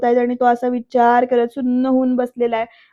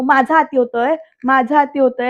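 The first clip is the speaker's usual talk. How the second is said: ordinary delivery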